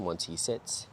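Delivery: ordinary talk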